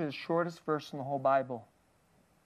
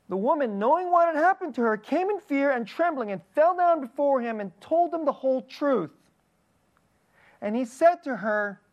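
higher, louder, impassioned scripture reading; preaching scripture; 9 semitones higher, 5 dB louder than usual. Acted. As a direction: proclaim the verse with rising fervor.

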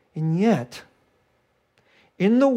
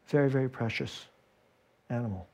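hushed tender; 8 semitones lower, 11 dB quieter than usual. Real, not acted. Lingering on the precious lamb.

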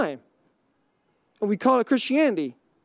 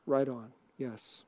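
hushed, slow, much softer reply; soft answer; 10 semitones lower, 12 dB quieter, slower than usual. Shown as a demonstration. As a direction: say it gently, almost under your breath. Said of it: "And the answer will be".

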